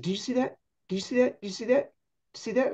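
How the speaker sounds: high, insistent questions repeated; mother to daughter, urgent excited high questions; excited urging. Retold or imitated. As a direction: press her daughter to look, pitch up. Mom urging daughter to look.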